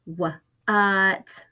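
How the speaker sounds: "Wha-, uh, t" broken into separate sounds; segmented sounds. Shown as a demonstration. Teacher splitting what into its sounds for first graders. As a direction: pull "what" apart sound by sound. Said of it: "I hear three sounds".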